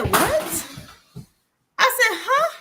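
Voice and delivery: quoting her own shock at a crude line, pitch jumping up; shocked what. Retold or imitated. To the crude date, a high shocked what and huh.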